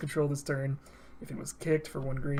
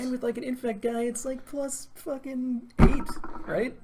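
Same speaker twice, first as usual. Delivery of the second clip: excited; 8 semitones higher, 3 dB louder, slower than usual. Real, not acted. Hyping a huge pump spell.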